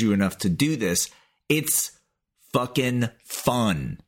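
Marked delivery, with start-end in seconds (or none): none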